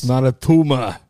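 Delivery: loud, mock Austrian accent; Arnold Schwarzenegger. Joking that the movie needs subtitles, mock Austrian accent. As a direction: do a hammed-up Austrian action star. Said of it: "with Arnold and Sylvester".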